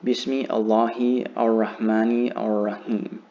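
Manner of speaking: "Bismi Allahi Ar-Rahmani Ar-Rahim" with every vowel and article pronounced, words not merged; unlinked, literal reading of "Bismi Allahi Ar-Rahmani Ar-Rahim"; read as transliterated. Shown as a demonstration. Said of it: "this one is basically telling you to say".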